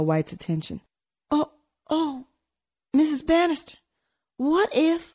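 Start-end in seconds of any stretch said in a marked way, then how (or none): 1.3-5.1 frightened. A narrator's reading. Frightened maid starts again.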